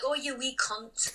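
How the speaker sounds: slow, playful Scottish lilt; cute, drawn-out Scottish accent. Shown as a demonstration. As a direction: say it like a cheeky Scottish person. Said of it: "like, a Scottish person".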